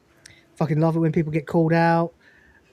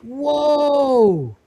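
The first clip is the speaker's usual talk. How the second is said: amazed; 4 semitones higher, much slower than usual. Real, not acted. Amazed at image.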